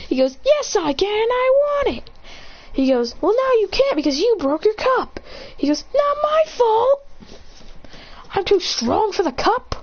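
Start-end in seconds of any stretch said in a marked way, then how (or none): 0.4-2.1 high, hammed-up tantrum; whiny protest. Childish argument over coffee. 3.2-5.2 high-pitched mock scolding; scolding retort. Acted scolding in coffee quarrel. 5.9-9.7 high-pitched, hammed-up protest; whiny defence. Whining defence over broken cup.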